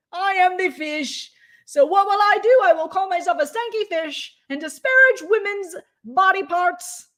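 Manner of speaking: high, loud grandiose mock-hero. Mocking the fish as a self-proclaimed caped savior, high and grandiose. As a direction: announce yourself like a cartoon savior. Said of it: "And I thought".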